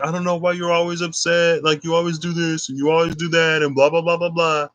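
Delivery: high mocking whine of "I don't know why you're always upset"; mocking boyfriend. Imitating her boyfriend's complaints, in a high mocking voice. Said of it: "he's like".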